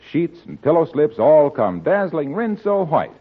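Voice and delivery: normal talking voice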